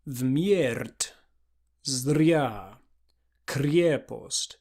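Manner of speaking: Вмерть, Зря, крепость with trilled R; rolled Russian R. Shown as a demonstration. Teacher modeling rolled R in Church Slavonic. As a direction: roll the R in each word. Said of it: "so we'd be saying in Russian".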